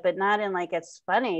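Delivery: ordinary talk, nothing marked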